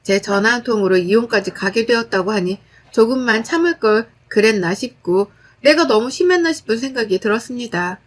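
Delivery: ordinary delivery, nothing marked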